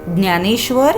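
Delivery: plain reading voice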